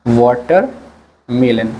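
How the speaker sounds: slow, drawn-out dictation of watermelon; slow teacher dictation; slow spelled-out word. Shown as a demonstration. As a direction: say watermelon slowly, syllable by syllable, for the class. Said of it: "watermelon का मतलब होता है तरबूज".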